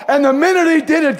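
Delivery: usual speaking voice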